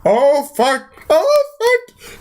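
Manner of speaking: high swearing that climbs into a squeak; mock panic. Loki realizing Hulk is there, hammed-up panic.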